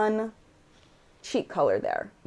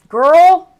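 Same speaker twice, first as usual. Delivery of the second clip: loud calling out; 10 semitones higher, 16 dB louder than usual. Real, not acted. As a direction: shout it much higher and louder. Calls out to friend.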